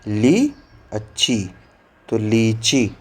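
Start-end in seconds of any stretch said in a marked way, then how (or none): 0.1-1.5 teacher breaking a word into parts; slow syllable demo. Shown as a demonstration. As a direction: split it slowly into ली and अच्छी.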